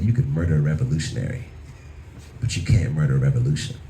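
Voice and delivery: low, cool acted defiance; mock-heroic cold composure; chill and cold. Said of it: "I stood up real chill and looked at him cold".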